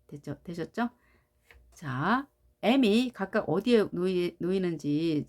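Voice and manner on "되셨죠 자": ordinary talk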